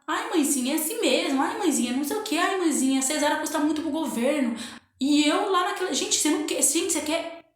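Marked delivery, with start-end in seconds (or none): 0.1-4.6 imitated nurse. Nurse to a woman in labour, mock-soothing imitation. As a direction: mimic a nurse brushing off a patient. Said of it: "uma enfermeira lá, gente, que ela".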